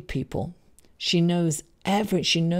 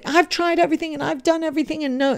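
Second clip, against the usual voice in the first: whiny client; 8 semitones higher, much faster than usual. Retold or imitated. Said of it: "And they say".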